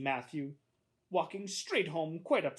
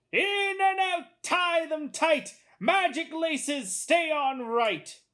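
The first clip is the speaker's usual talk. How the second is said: high, loud, slow barker's pitch; showy shoelace salesman; 8 dB louder, much slower than usual. A narrator's reading. Salesman drawing a boy to magic laces, loud showman. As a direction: hawk your wares, stretching every phrase.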